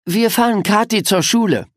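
hoch und etwas lauter, fröhliche Mama; Mamas Stimme. Acted. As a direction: als Mutter munter ankündigen. Mama spricht, hoch und laut. (fröhliche Mutterstimme)

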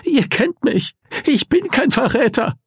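high, frightened protest; trembling voice. Acted. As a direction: insist shakily on innocence. Nees keeps pleading, frightened.

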